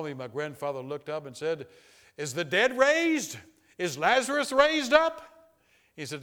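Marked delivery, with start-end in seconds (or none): 2.2-5.2 high, slow, booming cry; grandfather's loud exclamation. Retold or imitated. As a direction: call it out loud and drawn out, like an astonished grandfather. Grandfather's startled cry at breakfast table.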